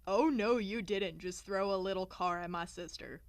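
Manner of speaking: loud protective "oh no"; big brother outrage. Retold or imitated. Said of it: "he was like".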